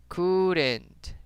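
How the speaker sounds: "couldn't" stretched slowly, L silent; drawn-out demo. Shown as a demonstration. Teacher shows students how it's said, drawn out. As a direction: draw out the first vowel of "couldn't". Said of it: "se dice".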